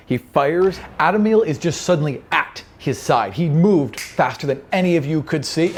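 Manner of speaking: normal talking voice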